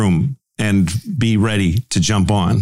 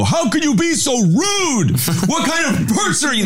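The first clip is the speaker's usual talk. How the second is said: mock angry Larry. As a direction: scold them like an indignant old man. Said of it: "he'd go".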